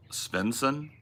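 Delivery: ordinary talk, nothing marked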